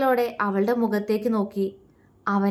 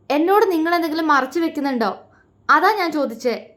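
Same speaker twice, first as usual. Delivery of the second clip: angry demand; 6 semitones higher, faster than usual. A narrator's reading. Wife confronting husband.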